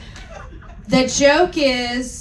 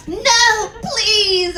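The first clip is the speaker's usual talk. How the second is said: high mock plea; begging; 9 semitones higher than usual. Heroine begging Voldemort, high mock plea. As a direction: beg in a high little voice. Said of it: "I begged".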